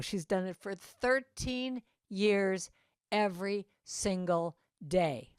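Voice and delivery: usual speaking voice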